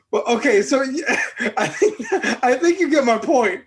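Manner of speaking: real laugh breaking into the sentence. Real, not acted. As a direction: giggle through the words. Laughing after own mock rant.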